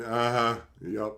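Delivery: ordinary delivery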